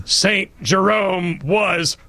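slow, drawn-out, stern pronouncement; mock schoolmarm declaring Saint Jerome wrong; imperious teacher. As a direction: hand down a verdict like a strict schoolteacher. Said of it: "invoke the spirit of Missus Champion".

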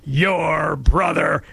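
very slow, stern, shouted verdict; imperious shout. Retold or imitated. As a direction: slam each word down like an angry teacher. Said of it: "said in an imperious tone".

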